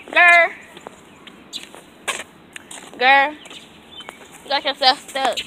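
loud, high, slow; exasperated call to dog. Real, not acted. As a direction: tell the stuck dog off. Dog stuck on leash.

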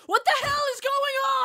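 very high, fast frantic cry; panicked shout. Acted. Sips coming out of crushing panic, frantic shout. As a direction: demand answers in a high-pitched panic.

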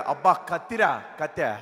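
slow, low admiring whistle-like line; admiring boy. Retold or imitated. Boy eyeing a pretty girl, slow and low. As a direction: drawl it out like a smitten lad.

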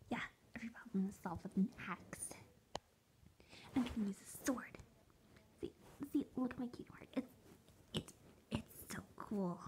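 lightly voiced Skilla, whispered; hushed and quick, a hint of Skilla; imitating Skilla. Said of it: "And that was Skilla".

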